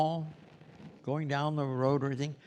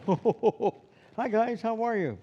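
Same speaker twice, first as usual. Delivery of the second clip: higher and louder, cheerful mid-level call; medium-loud Santa greeting; 10 semitones higher, 4 dB louder than usual. Shown as a demonstration. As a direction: call out to people walking by the set. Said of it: "You can have a louder, you know, medium".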